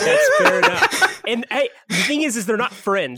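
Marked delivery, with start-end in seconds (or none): 0.0-1.2 high, loud, drawn out through laughter; laughing. Real, not acted. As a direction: concede the point while cracking up. Laughs at co-host's joking correction.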